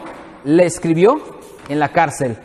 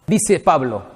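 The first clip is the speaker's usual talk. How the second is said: usual speaking voice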